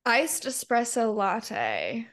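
slow, drawn-out teasing. Real, not acted. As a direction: spell it out slowly to tease. Teasing friend's wording.

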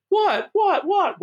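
high, puzzled what what what; a confused wrestler asking what happened; baffled what. Retold or imitated. Wrestler confused with a toupee on his tape, high baffled cry. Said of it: "Neidhart's going".

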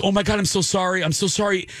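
high, hurried mock apology; frantic apology. Actress to girl with bloody nose, frantically apologetic. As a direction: imitate someone apologizing in a fluster. Retold horrified apology after accident.

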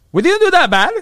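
smug lawyer. Retold or imitated. Attorney's smug quote.

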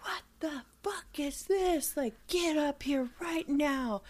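high, slow mock yell down the stairs, half breathy; hammed-up rage at the kids, drawn out and high; mock furious yell. Parents yelling at the kids over spilled ink, mock rage. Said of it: "they started screaming down the stairs".

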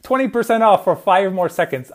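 loud, high pitchman hard sell; infomercial. Acted. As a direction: yell it like a TV pitchman. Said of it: "I'm not really an infomercial guy".

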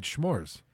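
"s'mores" said as shmores; slowed "s'mores" with sh at the front; sh pronunciation. Real, not acted. Host insists everybody says it so, "s'mores" as shmores. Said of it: "always said s'mores".

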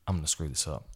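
hushed worried thought; quiet nervous aside to self; quiet inner dread. Retold or imitated. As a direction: say it low, dreading the moment. Inner dread before stage.

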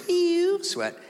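high squealed "ew"; mock panic. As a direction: squeeze out a panicky high noise. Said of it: "now I'm panicking".

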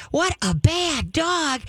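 high, slow, sugary "What a bad dog"; happy tone, bad words. Shown as a demonstration. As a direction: say "bad dog" like lavish praise. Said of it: "The tone of your voice is three quarters of your training".

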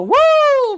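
high, loud "woo"; hooting at your own past glory; excited whoop. Real, not acted. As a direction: let out a big drawn-out whoop. Exclaims about being toned in 2014.

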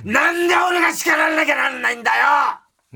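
high, loud frantic ranting; frantic shout. Acted. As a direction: yell it like a lovesick tantrum. Reads ranting letter in outburst. (半泣きで叫ぶ)

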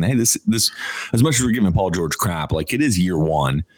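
usual speaking voice

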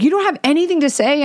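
high, quick incredulous question; mock indignant challenge. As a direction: imitate him demanding an answer. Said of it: "legit starts to yell at me".

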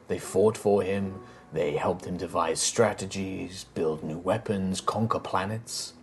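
put-on an old emperor's spirit recounting dark history; grave ancient spirit. GM voices Callus telling lore.

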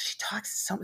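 put-on secretive child's whisper. Little girl whispering to grandma about unseen people. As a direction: lean in and whisper it. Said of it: "She goes".

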